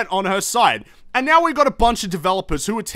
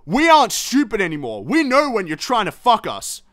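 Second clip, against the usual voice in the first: usual speaking voice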